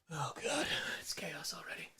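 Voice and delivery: whispered aside. Real, not acted. Streamer whispering about the dog's chaos. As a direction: whisper it under your breath.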